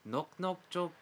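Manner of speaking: rushed, rattled-off question to the crowd; fast stage question. Acted. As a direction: fire it off quickly. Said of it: "I'm gonna say".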